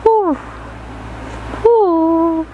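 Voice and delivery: usual speaking voice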